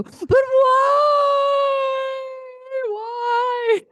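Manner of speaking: high, wailing shout of why; whiny little girl. Retold or imitated. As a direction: whine like an upset little girl. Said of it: "whereas girls go".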